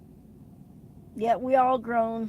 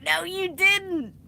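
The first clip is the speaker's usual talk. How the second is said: slow, higher, laughing reply; laughing disbelief; 9 semitones higher, 6 dB louder, slower than usual. Retold or imitated. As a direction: drag it out through laughter. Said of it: "I was like".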